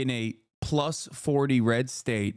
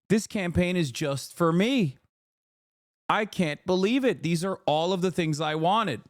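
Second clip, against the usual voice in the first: gushing in a higher voice; sarcastically thrilled, pitched up; mock delight; 5 semitones higher than usual. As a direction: act like an overjoyed supporter. Acted delight at the campaign.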